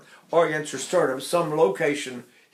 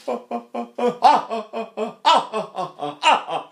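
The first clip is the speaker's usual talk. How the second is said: high hammered staccato ha; hammered staccato laugh; 7 semitones higher, 3 dB louder than usual. Shown as a demonstration. Teacher showing a hammered staccato. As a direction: punch each note like a laugh. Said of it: "I go, punch the staccato".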